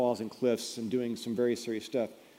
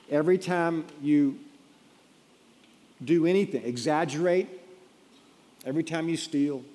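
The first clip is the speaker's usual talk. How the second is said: rising preacher's push, louder and higher; louder, higher preaching build; 4 semitones higher, 5 dB louder than usual. Real, not acted. Preacher to congregation, restarting after a joke: louder, higher push. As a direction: drive it home harder, pitched up.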